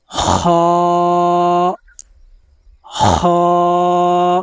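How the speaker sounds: "خا" scraped from the throat, like clearing phlegm; rasping throat. Shown as a demonstration. Teacher showing the throat scrape: a rasping "خا". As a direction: rasp "خا" hard at the back of the throat. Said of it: "جس طرح بندہ گلے سے بلغم کو نکالتا ہے".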